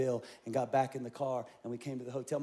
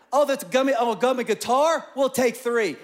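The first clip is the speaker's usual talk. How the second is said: excited shopping; 7 semitones higher, 9 dB louder than usual. Retold or imitated. Dad splurging at candy store.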